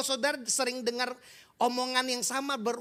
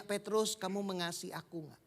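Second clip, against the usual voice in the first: gentle question; 3 semitones lower, 7 dB quieter than usual. Acted. Jesus asks Peter gently.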